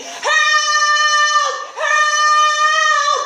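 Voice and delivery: high, loud, sing-song yell for help; desperate begging shout. Retold or imitated. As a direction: beg the hallway for help at full voice. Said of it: "I run back into the hallway begging".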